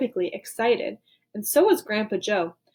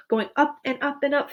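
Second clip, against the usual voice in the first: plain reading voice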